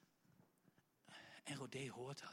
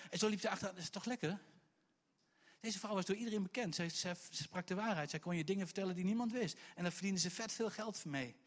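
normal talking voice

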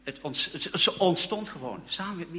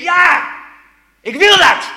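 much higher, much louder, ecstatic yell; full shout; 14 semitones higher, 20 dB louder, slower than usual. Retold or imitated. Young man on a wall answers the inner voice, full shout. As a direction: leap up and shout your answer.

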